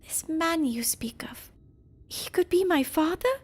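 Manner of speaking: put-on high, earnest boy's voice; young boy voice. Child character voiced in game.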